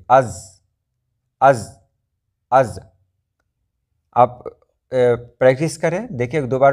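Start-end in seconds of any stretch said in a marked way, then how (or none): 0.1-2.8 از with no breath after the z; از said as a stopped z with no puff of air; zaal sound, no air. Shown as a demonstration. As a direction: cut the air off right after the z in از. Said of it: "آپ کے منہ سے کوئی ہوا نہیں نکلنی چاہیے".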